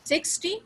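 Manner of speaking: Sixty with high SIX; Sixty, punched front and light ty; stress on first syllable. Shown as a demonstration. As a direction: say Sixty as SIX-ty, short ending. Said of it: "second syllable पे".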